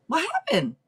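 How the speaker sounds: sudden high alarmed question; alarmed surprise. Real, not acted. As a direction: jump up in alarm.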